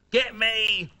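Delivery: petulant, insistent outburst; angry demand. A narrator's reading. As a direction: order mum about crossly. Angry son demands bananas.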